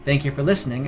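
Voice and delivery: ordinary delivery, nothing marked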